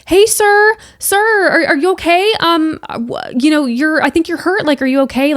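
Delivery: calling to a hurt stranger, urgent and high. Retold or imitated. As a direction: call to him to get his attention. Calling out to the injured man.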